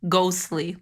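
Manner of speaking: ordinary delivery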